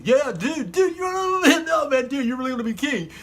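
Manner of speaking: high-pitched hyped-up dude; excited insistence. Acted. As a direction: insist to your stunned buddy. Insisting to a disbelieving David.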